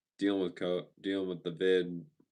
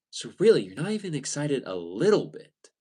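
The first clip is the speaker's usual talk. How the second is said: high, louder, incredulous salesman voice; imitating disbelief; 7 semitones higher, 6 dB louder than usual. Salesman returns to ask again, hammed-up disbelief. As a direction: push the question up in mock disbelief. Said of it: "he's just like".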